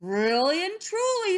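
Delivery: high, loud, emphatic wolf promise; earnest assurance. A narrator's reading. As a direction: swear it eagerly. Wolf earnestly assures Missus Duck.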